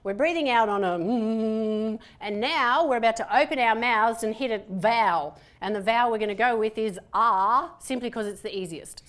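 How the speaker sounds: usual speaking voice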